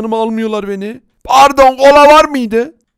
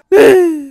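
loud, high gasp; startled gasp; 9 semitones higher, 4 dB louder than usual. Acted. Villager gasps while searching for their house, alarmed.

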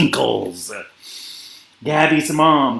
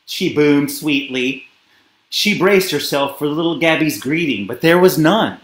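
ordinary delivery

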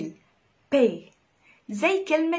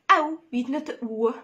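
usual speaking voice

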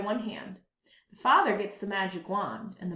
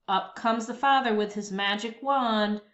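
ordinary delivery

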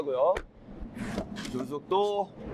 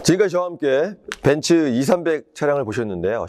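upbeat host wrap-up; presenter outro; 2 semitones lower, 7 dB louder than usual. Acted. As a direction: wrap up like a car show host. Closing the car showcase. (쇼호스트 마무리 멘트)

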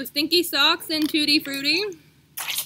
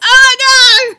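high shriek of disgust; loud disgusted shriek; 10 semitones higher, 19 dB louder than usual. Real, not acted. Reacting to gross taste.

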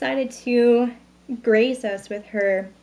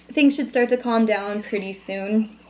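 ordinary talk

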